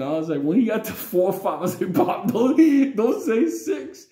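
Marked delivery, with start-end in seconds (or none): 1.9-4.1 high, drawn-out plea; retold panicky high pleading; alarmed plea. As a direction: beg Pop in a raised, worried voice. Begging father not to count on.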